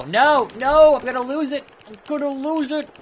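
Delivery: fretting that the match is slipping away; rising alarm as the match slips away; panicked gamer. Real, not acted.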